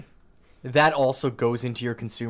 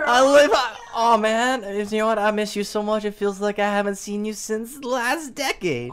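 melodramatic voice; 7 semitones higher than usual. Pretend. Mock dramatic reunion bit.

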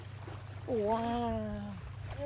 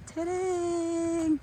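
normal talking voice

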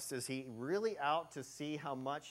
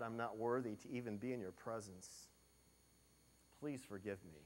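softer, fading plea for mercy; a sinner begging God quietly for forgiveness; humble pleading prayer; 6 semitones lower, 7 dB quieter than usual. Acted. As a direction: beg forgiveness under your breath.

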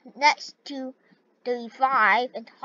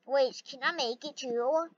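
ordinary delivery

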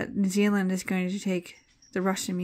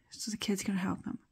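fast, softer unvoiced whisper; 5 dB quieter, faster than usual. Shown as a demonstration. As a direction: whisper it quickly to yourself. Repeated line, whispered.

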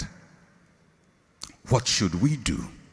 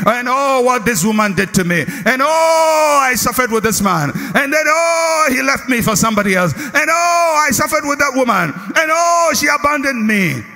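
mock complaining; 9 semitones higher than usual. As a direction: parody someone whining about their exes, fast. Mimics the complainers in the congregation.

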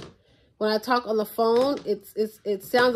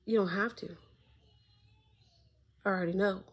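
soft, resigned inner thought; 8 dB quieter than usual. Retold or imitated. Thinking to herself about the dropped phone, soft and resigned. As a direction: say it quietly to yourself, already defeated. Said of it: "In my head, I was just like".

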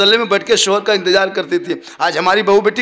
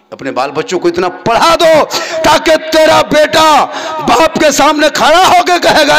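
full-force preaching; 9 semitones higher, 10 dB louder than usual. Acted. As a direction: thunder it from the pulpit. Preacher urges parents to educate. (ऊँची आवाज़ में जोशीली तक़रीर)

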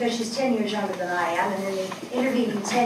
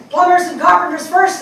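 loud, higher barked command; brusque order; 9 semitones higher, 11 dB louder than usual. Retold or imitated. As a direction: bark it like a harried official. Volunteers told to wait.

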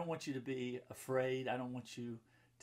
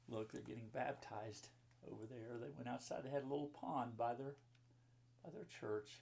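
normal talking voice

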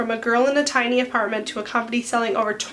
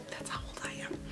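whispered aside; 20 dB quieter, faster than usual. Real, not acted. Aside about her age.

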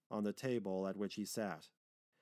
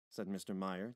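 ordinary delivery, nothing marked

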